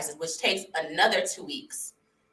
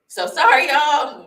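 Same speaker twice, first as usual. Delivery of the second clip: real emotional apology, higher and louder; 6 semitones higher, 7 dB louder, slower than usual. Real, not acted. Telling viewers her story the first time, emotional. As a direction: apologise while choked with feeling. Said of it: "I'm just emotional".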